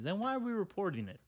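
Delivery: ordinary talk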